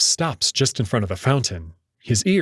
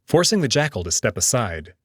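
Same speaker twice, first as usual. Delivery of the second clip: plain reading voice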